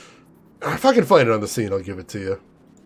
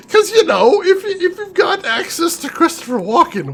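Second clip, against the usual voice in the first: laughing; 4 dB louder than usual. Real, not acted. Laughing through the Walken story.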